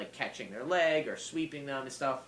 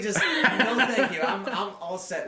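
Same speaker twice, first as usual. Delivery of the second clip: loud, high, laughing through the words; bow out loudly while cracking up; laughing refusal; 9 semitones higher, 10 dB louder than usual. Real, not acted. Fighter declining straight wars in sparring, laughing refusal.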